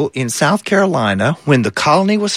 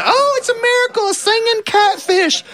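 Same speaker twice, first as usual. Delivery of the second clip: amazed churchgoers. Acted. Churchgoers marvel at the singing catfish in high awed voices. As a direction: gush with wonder at a miracle. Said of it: "people would say".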